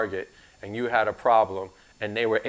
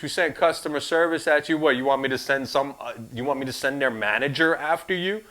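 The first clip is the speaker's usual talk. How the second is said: ordinary delivery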